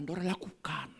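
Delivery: whispered urging; hushed preacher aside. Real, not acted. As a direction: breathe it to the congregation.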